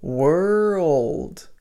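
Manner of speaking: put-on "world" said as one linked "were-old"; "world" with the linking sound. Shown as a demonstration. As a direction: blend "were" into "old" smoothly. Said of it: "I'm saying were old".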